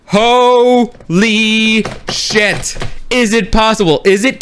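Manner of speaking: drawn-out, stretched awe; awestruck. Retold or imitated. Awestruck reaction to character's entrance.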